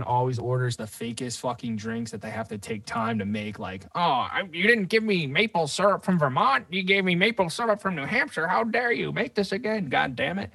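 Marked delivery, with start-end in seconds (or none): 3.9-10.5 high, loud mock customer tirade; hammed-up indignant complaint; mock outraged customer. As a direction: imitate a fussy customer throwing a fit. Mocking an outraged picky customer.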